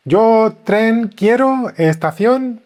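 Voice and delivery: slow, halting, stilted beginner's Spanish; awkward broken Spanish. Pretend. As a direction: stumble through it like a lost tourist. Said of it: "something weird like".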